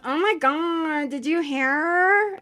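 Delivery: a hint of a Kardashian-style gossip; Fran Drescher-like boss. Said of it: "she goes".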